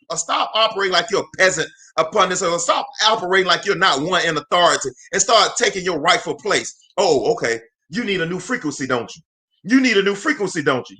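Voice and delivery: ordinary talk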